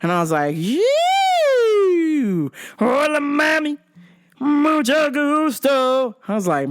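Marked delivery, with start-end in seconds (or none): 0.5-6.2 high, drawn-out cooing; hammed-up come-on; flirty mock leer. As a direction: croon it slowly at the waitress. Acted leering reaction to waitress.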